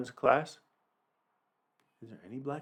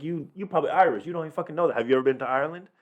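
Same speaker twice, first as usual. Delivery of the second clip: higher, needling comeback; mock challenge; 6 semitones higher, faster than usual. Firing back at someone who questioned their background, mock challenging. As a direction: challenge them in a higher pitch, half joking.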